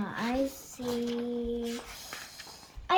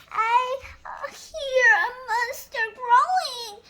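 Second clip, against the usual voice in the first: high, louder stammering fright; frightened cry; 11 semitones higher, 9 dB louder than usual. Acted. Dragon answering Squatter, high scared cry. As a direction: blurt it nervously, voice up high. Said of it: "cried Dragon".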